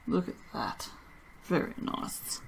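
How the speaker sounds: soft whispered admiration. Real, not acted. Soaper admiring the drop swirl, soft whisper. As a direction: breathe it out in a whisper.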